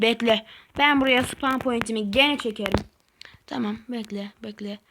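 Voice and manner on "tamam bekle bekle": hushed and careful, as if not to disturb; tense whisper. Real, not acted. Player whispering to himself through a tricky jump. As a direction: whisper it, holding breath.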